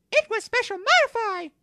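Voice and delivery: very high, squeaky little creature voice; a tiny creature piping up in a high squeak; Umbreon voice. Acted. Umbreon explains to Mew three in a high squeaky voice. Umbreon speaks for first time.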